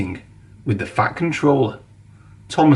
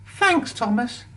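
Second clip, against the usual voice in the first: higher, softer happy thanks; a higher, soft little engine making up; grateful; 11 semitones higher, 4 dB quieter than usual. A narrator's reading. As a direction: thank your friend warmly. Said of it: "said Percy".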